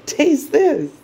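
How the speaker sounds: high, pushy little offer; eager Venus. Acted. Venus in Sagittarius offers the moon strange candy, pitched high. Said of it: "Venus in Sagittarius is like".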